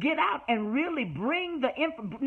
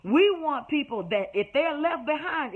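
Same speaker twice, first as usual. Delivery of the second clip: ordinary talk, nothing marked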